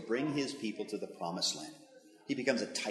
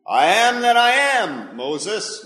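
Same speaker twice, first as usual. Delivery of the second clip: slow, much louder declaration, pitch falling away; God declares his name to Moses, booming and slow; 8 semitones higher, 14 dB louder, much slower than usual. Acted. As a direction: proclaim it slowly and grandly.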